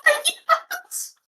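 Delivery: squealing, laughing yes; excited laughing cheer. Real, not acted. Cheering as vote count hits target.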